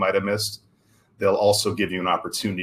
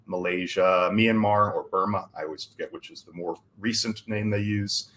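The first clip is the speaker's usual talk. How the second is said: ordinary talk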